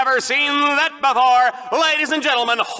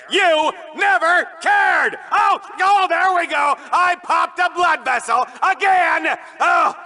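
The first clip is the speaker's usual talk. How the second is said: hammed-up rant; 9 semitones higher than usual. Host ranting at co-host until he pops a vessel.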